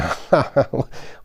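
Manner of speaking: high, creaky cackle; wheezing old man's cackle. Acted. Old Joe laughing at himself, a creaky cackle. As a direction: cackle at your own joke.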